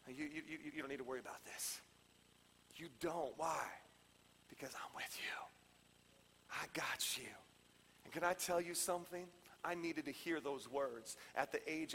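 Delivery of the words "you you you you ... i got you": very soft, low near-whisper; quiet, protective murmur; tender reassurance. Acted. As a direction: comfort them in a hushed, intimate voice. Jesus reassuring, voice dropped.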